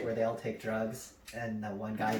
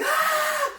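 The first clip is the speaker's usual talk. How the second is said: very high, loud mock scream of terror; imitated scream; 7 dB louder than usual. Brother screaming until the ride is over, imitated scream. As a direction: scream like a panicking coaster rider. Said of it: "he starts screaming".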